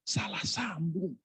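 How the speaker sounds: drawn-out, high whispered aside; hushed comic thought to oneself; inner-thought whisper. Retold or imitated. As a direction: whisper it slowly as a private complaint. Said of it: "Dalam hati saya".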